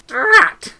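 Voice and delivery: a sharp, high, loud cry of Drat!; too much emphasis on Drat!; exclaimed drat. Shown as a demonstration. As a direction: blurt out Drat! loudly, up high. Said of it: "she said with no particular emphasis".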